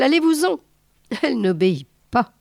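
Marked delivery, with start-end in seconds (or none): 0.0-0.6 un ordre crié, plus aigu; chasse-la en criant. A narrator's reading. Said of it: "cria-t-il". (crié, voix plus haute)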